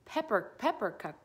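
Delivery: plain reading voice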